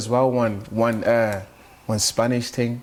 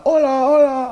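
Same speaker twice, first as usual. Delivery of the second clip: high, louder mock squeal of hola; imitating a fan; 13 semitones higher, 6 dB louder than usual. Retelling a Spanish girl greeting him, high mock squeal. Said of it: "she's like".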